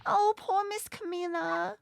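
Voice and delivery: put-on worried little kid calling out; child character voice. Children finding Camila, high and worried.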